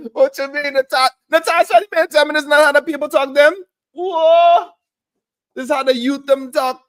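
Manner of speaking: high, fast Caribbean patois protest; Caribbean accent. Retold or imitated. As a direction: defend the patois to Natasha in quick Caribbean accent. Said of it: "Caribbean accent from my people".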